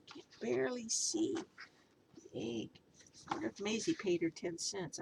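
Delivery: usual speaking voice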